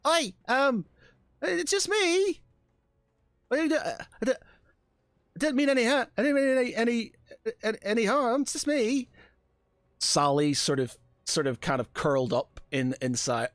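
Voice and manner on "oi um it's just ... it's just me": high Cockney voice, much higher; startled Cockney girl caught hiding in a crate. Acted. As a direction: play a sneaky Cockney stowaway, high pitched. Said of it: "what sort of accent did I give her".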